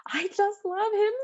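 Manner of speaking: slow, squealing impression of melodramatic sobbing; overdramatic sobbing. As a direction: wail it tearfully, hammed up for laughs. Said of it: "she's, like, holding the baby, and she's sobbing".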